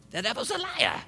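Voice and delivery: high-pitched Pentecostal preacher's cry; Pentecostal accent. Shown as a demonstration. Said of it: "with a little Pentecostal accent".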